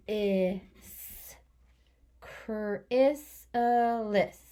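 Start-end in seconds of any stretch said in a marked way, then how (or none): 2.2-4.4 chrysalis drawn out very slowly; stretched out. Shown as a demonstration. Teacher sounding out chrysalis very slowly. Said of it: "I use my kid spelling".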